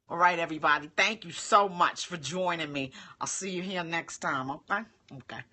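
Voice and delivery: normal talking voice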